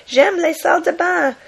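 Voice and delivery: "J'aime les salles de bain" high and loud in cartoon French; mock French voice. Nonsense French from a comic bystander, mock French. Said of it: "another guy says".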